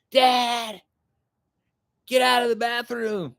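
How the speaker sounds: high, drawn-out holler for a parent; high-pitched impatient mock yell; imitated kids yelling. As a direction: imitate a kid hollering for Dad. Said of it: "And they're yelling".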